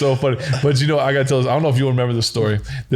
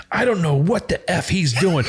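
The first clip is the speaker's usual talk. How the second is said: higher, drawn-out grumbling to himself; exasperated coach; 5 semitones higher than usual. Retold or imitated. Coach venting unaware the quarterback hears, exasperated. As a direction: imitate a baffled coach venting. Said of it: "this is exactly how it'd go".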